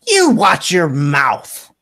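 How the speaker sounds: loud, high, slow acted reprimand; indignant scolding. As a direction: tell off a grown child, drawing out each word. Mother scolds her daughter.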